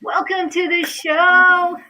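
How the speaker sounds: high, loud, drawn-out announcer greeting; showy welcome. Real, not acted. Host welcoming her guest, big slow fanfare. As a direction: sing it out like a show opener.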